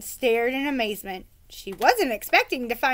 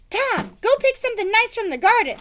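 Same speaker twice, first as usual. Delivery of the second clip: mother calling son; 10 semitones higher, 4 dB louder than usual. Acted.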